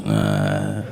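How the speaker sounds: a dull low "uh" grunt, barely awake. Retold or imitated. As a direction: grunt into the phone like a caveman. Phone man grunts again.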